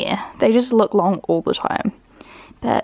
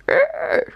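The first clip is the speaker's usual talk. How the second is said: high squeaky yawning growl; high yawn-growl like a tiny dinosaur; imitated dog yawn. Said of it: "He makes a really weird yawning noise".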